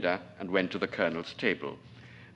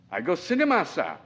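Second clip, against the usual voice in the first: pidgin English; 7 semitones higher, 3 dB louder than usual. Acted. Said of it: "The pidgin English was a surprise".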